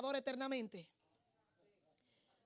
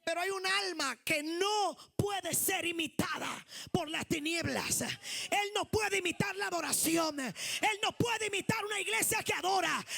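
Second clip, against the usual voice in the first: loud, higher preaching building to shouts; full-force preaching; 5 semitones higher, 5 dB louder than usual. Acted. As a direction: hammer each line home to the church.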